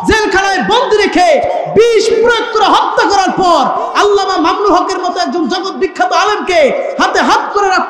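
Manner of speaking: high, loud impassioned sermon chant; rousing the congregation with a loud, high sermon cry; fiery preaching. Acted. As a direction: thunder it out to the crowd like a waz preacher. Fiery sermon climax on martyrs.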